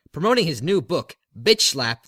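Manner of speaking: usual speaking voice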